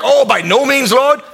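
high, squeaky mock outrage, rising on Lord; a prim believer recoiling in horror; mock shocked protest. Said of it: "that you're like".

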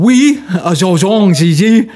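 hammed-up French with a full mouth; over-the-top French accent; mushy French accent. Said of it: "mashed potatoes in your mouth".

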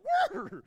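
a high, loud mock snort of frustration; angry snort. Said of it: "You can almost imagine Jesus like".

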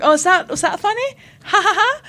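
high-pitched sarcastic questioning; scornful, hammed-up "ha ha ha"; mock indignation. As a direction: pretend to be affronted, ending in a fake laugh. Acted indignation with fake laugh.

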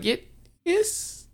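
a strained, very high-pitched little yes; squeaky forced yes. Retold or imitated. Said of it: "She was like".

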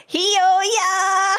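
high, drawn-out cry; playful high-pitched call. Shown as a demonstration. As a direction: pitch it way up and hold the last syllable. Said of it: "you say it in a high-pitched voice".

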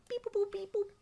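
high beeping robot noises; toy robot voice. Pretend. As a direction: beep like a little toy robot. Can opener called an automaton.

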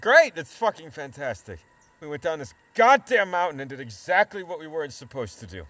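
loud and bitter, blaming the bad choice; angry outburst; bitter anger.

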